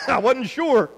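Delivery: laughing loudly through the words; can barely get it out for laughing. Real, not acted. Laughing at the memory.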